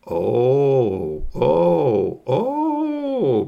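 loud, swooping oohs of pleasure; drawn-out ecstatic ohs; rapturous delight. Acted. Alien tastes yogurt and loves it.

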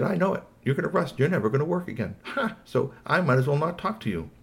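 high-pitched toy gloating; taunting Squeaky Toy. Acted. Toy telling the boss he will rust, taunting. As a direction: rub it in with a high scoffing "Ha!".

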